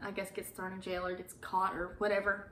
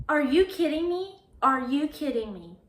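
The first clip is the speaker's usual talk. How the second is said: acted outrage; 5 semitones higher, 5 dB louder than usual. Catches boyfriend in bed with girl.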